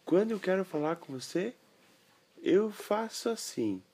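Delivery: put-on high child voice, slowing; high boy voice; little boy voice. Boy explains the signal.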